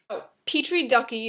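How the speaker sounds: ordinary talk, nothing marked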